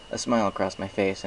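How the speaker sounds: usual speaking voice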